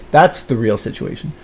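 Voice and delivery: ordinary delivery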